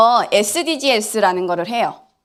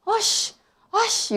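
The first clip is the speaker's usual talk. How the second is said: mock outburst; 11 semitones higher, 5 dB quieter, much slower than usual. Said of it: "이런다고요".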